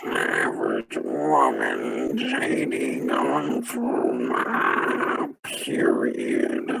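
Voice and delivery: slow warped robotic voice; morphing voice. Pretend. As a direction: voice changer sliding down low. Voice changer dropping low.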